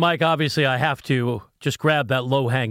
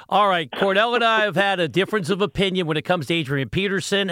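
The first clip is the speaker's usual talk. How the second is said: ordinary talk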